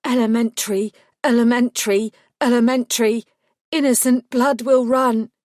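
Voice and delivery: loud eerie prophetic chant; possessed, words from far away; trance voice. Acted. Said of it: "my own words coming at me as if from a long way off".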